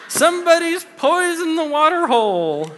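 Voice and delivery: high, loud, laughing cowboy line; a laughing, loud toy cowboy call; Woody impression. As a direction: shout it like the pull-string Woody, cracking up. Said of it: "all that stuff that Andy says".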